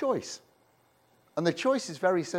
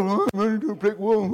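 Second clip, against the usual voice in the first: fast whiny moan; hammed-up moaning; mock whining; 4 semitones higher, 4 dB louder, faster than usual. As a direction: whine like a self-pitying complainer. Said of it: "whining and complaining and moaning".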